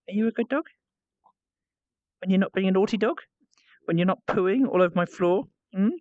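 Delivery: coo-question a naughty dog; lilting up at each question's end; teasing pet talk. Real, not acted.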